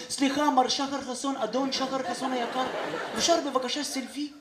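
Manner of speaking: soft, overly polite mock request; מנומס. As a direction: impersonate a timid, courteous fan, very quietly. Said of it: "והם מנומסים". (חיקוי מנומס ושקט)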